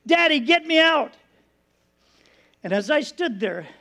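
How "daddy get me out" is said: put-on high, urgent child's plea; retold scream, pitched up but short of full volume; imitated terrified scream. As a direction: cry out like a trapped small child. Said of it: "he's screaming".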